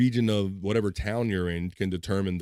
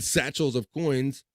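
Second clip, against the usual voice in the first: usual speaking voice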